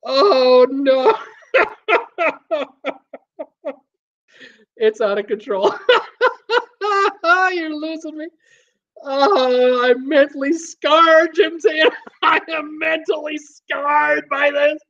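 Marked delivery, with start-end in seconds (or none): 0.1-3.8 high wail through laughter; real laughing fit. Real, not acted. Helpless laughing fit, named later. 5.9-14.8 high-pitched words squeezed through laughter; cracking up, barely getting words out; real laughing fit. Real, not acted. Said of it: "I'm laughing so hard".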